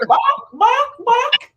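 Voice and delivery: squeaky high barking; high mock bark. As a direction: bark like a tiny chicken. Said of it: "The chicken say".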